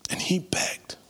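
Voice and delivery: very soft, breath-only emphasis; whispered. Real, not acted. Preacher returning to Paul's begging after his own plea, whispered. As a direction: let it fall to a whisper.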